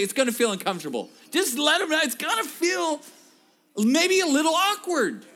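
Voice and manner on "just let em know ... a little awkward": high, loud urging sermon voice; preaching. Acted. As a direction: push the crowd to say it.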